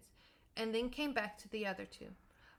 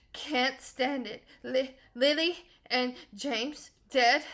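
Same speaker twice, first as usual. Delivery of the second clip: sobbing; 3 semitones higher, 10 dB louder than usual. A narrator's reading. Hagrid grieving Harry's parents, loud sobbing. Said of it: "sobbed Hagrid".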